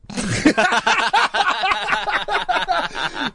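high burst of real laughter; big high laugh at a reply. Real, not acted. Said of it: "it's also made me laugh".

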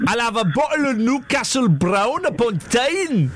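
slow Geordie attempt, half Scottish, half Indian; hammed-up, drawn-out bad Geordie; botched Geordie accent. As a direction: badly fake Newcastle, drifting Scottish-Indian. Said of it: "a mixture between Scottish and Indian".

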